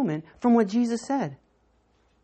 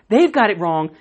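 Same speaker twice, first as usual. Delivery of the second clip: normal talking voice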